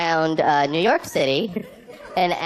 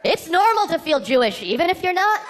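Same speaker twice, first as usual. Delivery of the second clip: official sign announcement; 10 semitones higher than usual. Acted. Comedian announcing his prank signs to the crowd, high mock-official proclamation. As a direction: call each sign out high and drawn-out.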